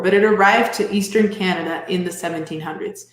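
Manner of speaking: usual speaking voice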